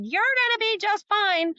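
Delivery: a lightly voiced reassuring mentor; mentor's reassurance. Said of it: "my mentor ... said".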